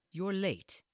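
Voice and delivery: a clipped cold greeting at the door. A narrator's reading. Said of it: "her tone as cold as the frigid air".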